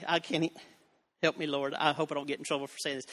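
ordinary talk

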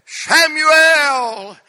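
loud, high call of the name. Retold or imitated. God calling the boy in the night, loud high call. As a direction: call out across the dark.

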